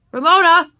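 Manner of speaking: loud, high parental warning; stern father calling out. A narrator's reading. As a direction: call the child's name sharply as a last warning. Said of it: "voice held a warning note".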